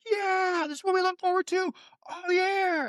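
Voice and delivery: very high, thin hooray; hammed-up crowd excitement; mock fan cheering. Host imitating decked-out sideline fans, high mock cheer. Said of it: "all these people on the sidelines".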